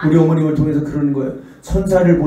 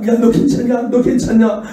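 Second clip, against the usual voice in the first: higher, louder anxious mother; worried mother; 7 semitones higher, 4 dB louder than usual. Retold or imitated. Mother checking her child after the accident, high and anxious. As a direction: fuss over the child loudly. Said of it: "이 엄마가".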